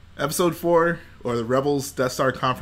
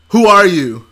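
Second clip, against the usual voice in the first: demanding question, a little louder; lightly voiced Krennic; 4 semitones higher, 10 dB louder than usual. Villain demands a stranger's name, lightly voiced. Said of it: "and he's like".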